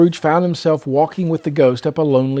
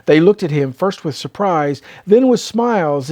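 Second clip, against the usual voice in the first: ordinary delivery, nothing marked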